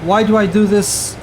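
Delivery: ordinary talk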